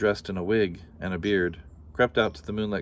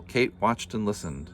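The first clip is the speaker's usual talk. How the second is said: ordinary delivery, nothing marked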